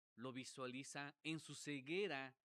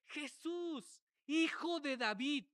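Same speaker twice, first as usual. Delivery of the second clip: high, loud imitated cry; raised-pitch yell, building louder; retold shout; 10 semitones higher, 8 dB louder, slower than usual. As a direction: shout it like a blind beggar calling out. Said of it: "Sino que gritó".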